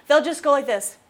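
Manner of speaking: normal talking voice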